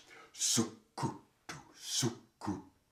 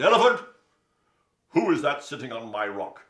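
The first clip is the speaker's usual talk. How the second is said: lion calling; 4 semitones higher, 14 dB louder than usual. Acted. Lion calls to Elephant.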